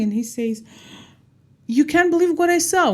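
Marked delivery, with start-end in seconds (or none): 1.7-2.9 higher, louder rush of excitement; excited telling. Acted. Mister Circle bursting to tell his friends, excited. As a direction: burst out with the news.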